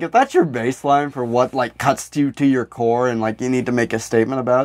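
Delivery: usual speaking voice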